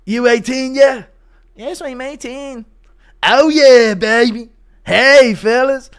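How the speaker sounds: high-pitched Quagmire and McGregor mash-up; exaggerated cartoon lecher with an Irish twist; Conor McQuagmire. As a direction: play a hammed-up, high Quagmire. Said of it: "Conor McQuagmire impression".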